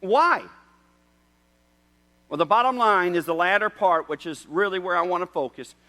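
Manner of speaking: high, loud impassioned preaching; fiery pulpit appeal; preaching at full force. Acted. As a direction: drive each question home. Preaching climax.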